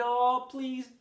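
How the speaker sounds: loud, high desperate begging; pleading. A narrator's reading. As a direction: beg for your life on your knees. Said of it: "He pleaded".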